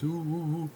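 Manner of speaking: a string of high-pitched mock "ooh"s; playful high oohing. Joking about being a physical guy, high playful oohs. Mimes a cooing sound, joking.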